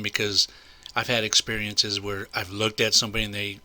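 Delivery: ordinary delivery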